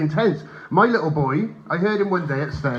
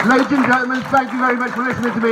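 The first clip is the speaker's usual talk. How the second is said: high, loud farewell over applause; stage sign-off; 9 semitones higher, 4 dB louder than usual. Real, not acted. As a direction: call out your thanks to the whole room. Comedian signs off over applause.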